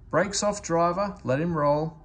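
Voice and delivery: an inspector giving a firm command; stern inspector. Acted. Inspector orders the driver to let the engine roll, stern command.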